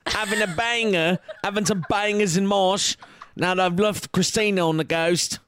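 hammed-up Cockney-ish British accent, a bit fast; light mock British accent, sloppy and fast. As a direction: play him as a cheeky London bloke in a light British accent. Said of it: "apparently he's British".

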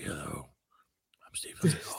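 low, whispered self-introduction; sinister mock action-star whisper; Steven Seagal. Host shows how Seagal talks in interviews, sinister whisper. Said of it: "He, like, whispers".

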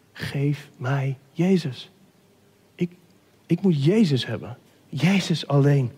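low, slow and halting; subdued heartfelt plea. Acted. Weeping believer asking for Jesus alone, subdued. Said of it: "en je zegt het".

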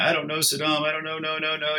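high, pleading denial; lightly voiced panicky old man; a hint of a scared old cook; old cook's frantic denial. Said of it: "And he's telling me".

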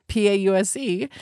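spelled letter by letter P A U S E; spelling it out. Shown as a demonstration. Spelling pause for the listeners, letter by letter. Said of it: "literally taking a pause".

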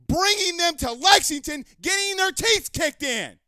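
high, loud, emphatic rant. Real, not acted. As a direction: shout it in real exasperation. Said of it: "raise my voice".

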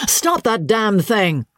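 high, drawn-out irritated outburst; angry howl. A narrator's reading. George howls from the sofa at the piano playing, angry. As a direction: howl it in exasperation, high and slow. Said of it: "George howled from the sofa".

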